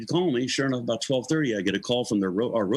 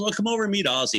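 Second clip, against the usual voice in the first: higher, imitated hostess invitation; Sharon, nice and polite; 8 semitones higher, faster than usual. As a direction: politely invite the newcomer over. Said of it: "She's all nice and polite".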